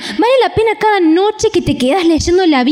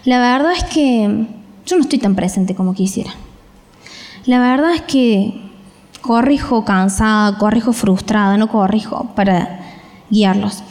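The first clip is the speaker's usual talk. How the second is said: tired confession; 7 semitones lower than usual. Retold or imitated. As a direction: sigh it out like a worn-out parent. Parent confessing own tiredness. (confesión cansada, más grave y lenta)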